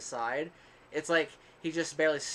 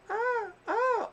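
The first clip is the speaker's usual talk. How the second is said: high-pitched mock moans; imitating moaning; 6 dB louder than usual. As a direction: mimic her rhythmic moaning, pitched way up. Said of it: "I hear her going".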